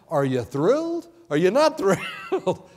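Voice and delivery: loud, laughing questions; preacher laughing at the reveal; laughing excitement. Real, not acted. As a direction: tease them with delight.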